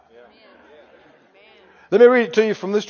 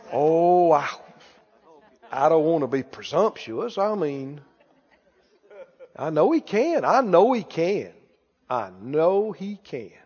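low, soft, halting mock reluctance; mock hesitant believer; 8 semitones lower, 7 dB quieter than usual. Preacher mimicking Christians who won't say God protects them, mock hesitant. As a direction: imitate a timid, noncommittal churchgoer, voice sunk low.

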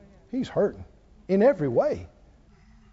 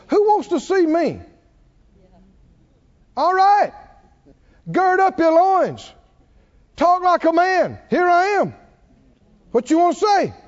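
high, forceful summons; commanding challenge, calling someone out; God; 6 semitones higher, 3 dB louder than usual. Retold or imitated. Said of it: "And God spoke out of it and said".

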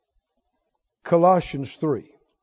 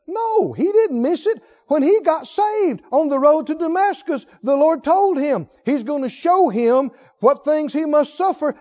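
high-pitched, insistent preacher delivery; impassioned preaching; 8 semitones higher than usual. Real, not acted. Preacher rebutting theologians who said Paul missed it, impassioned. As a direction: drive each phrase home with raised pitch. Preacher building to full force.

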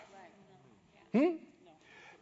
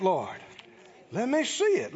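ordinary talk

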